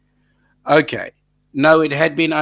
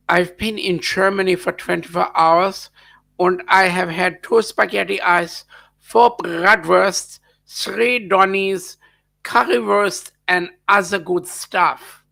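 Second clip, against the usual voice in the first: higher-pitched mock German accent, slowing through the list; 5 semitones higher than usual. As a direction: put on a playful German accent. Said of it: "when I use the accent".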